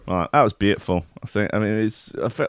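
ordinary talk, nothing marked

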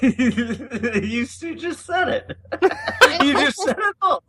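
high-pitched words breaking into laughter; real laughter. Real, not acted. Co-host reacting to a friend's summary, laughing hard. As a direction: crack up while trying to talk.

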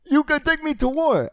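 high, strained, droning mock South African voice; bad South African accent. Pitching the movie's hero threatening war, bad South African accent. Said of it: "someone who definitely can't do a South African accent".

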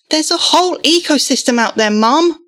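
put-on boy's voice pitched high, losing his calm; agitated plea. A narrator's reading. Boy losing his calm with Mum, agitated and high. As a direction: push back at Mum, calm slipping away. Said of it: "His own calm was fleeing".

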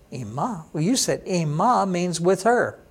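ordinary talk, nothing marked

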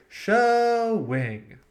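high, loud drawn-out schwing; Schwing catchphrase. Retold or imitated. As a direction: sing out a cheeky schwing. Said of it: "or you can also say".

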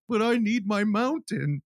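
high, slow, hammy plea; mock pathetic pleading. Husband begs wife on the phone, mock pathetic pleading. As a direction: imitate a weepy husband begging. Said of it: "fucking pathetic motherfuckers".

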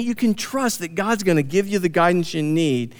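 ordinary talk

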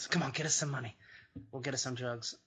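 ordinary talk, nothing marked

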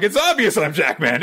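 high, laughing boast. Retold or imitated. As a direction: laugh through the brag.

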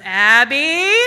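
loud call from the next room. Retold or imitated. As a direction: yell the name across the house. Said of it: "at the top of my mom's lungs".

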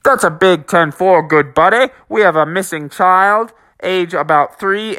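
put-on police radio voice; measured officer radio patter. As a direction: report it like a dispatch call. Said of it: "got on his radio and said".

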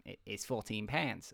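put-on low, soft go at an Australian accent; attempted Australian accent. Bakery man giving a customer the price, attempted Australian accent. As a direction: have a half-successful stab at Australian. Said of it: "first sentence in his accent".

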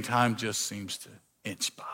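breathy dragging whisper. Acted. Preacher to congregation about waiting, whispered. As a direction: let the words crawl out in a whisper.

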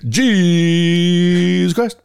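slow booming announcer. Retold or imitated. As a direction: draw it out like a TV host. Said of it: "that's the way they announce it".

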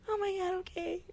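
small, high, soft voice; retold reaction, very high and soft; overcome acceptance. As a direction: squeak it softly, as if overcome. Retold reaction to being reassured.

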